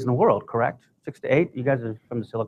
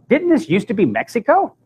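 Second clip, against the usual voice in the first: high-pitched mock question, rising on Mexico; 8 semitones higher than usual. Shuttle woman asking him about Mexico, questioning. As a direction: imitate a puzzled woman, much higher.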